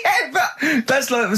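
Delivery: high shrieking laugh breaking into words; burst of laughter. Real, not acted. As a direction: laugh out hard, then squeeze the words through. Friends mocking his thumbs.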